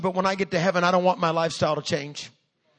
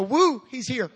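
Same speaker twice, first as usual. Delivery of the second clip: high whoop of arrival; imitated family cheer; mock excited whoop; 5 semitones higher, slower than usual. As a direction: whoop it up as if playing along. Family jumps up cheering rapture.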